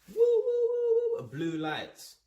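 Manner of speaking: high wailing "woo" like a siren; imitated police siren. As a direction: mimic the sirens of a chasing police car. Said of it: "There's all sirens".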